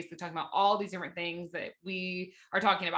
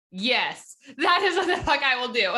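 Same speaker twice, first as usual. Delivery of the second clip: loud, high, fired-up agreement; fired-up yes; 7 semitones higher, 8 dB louder, slower than usual. Retold or imitated. Guest retells answering her friend, loudly fired up. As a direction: shout it out like you're all in.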